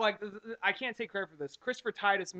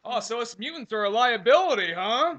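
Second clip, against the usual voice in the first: loud, higher mock mutant rant; Beast voice; 3 semitones higher, 7 dB louder than usual. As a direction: play the offended mutant. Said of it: "introduce you to my friend, Beast".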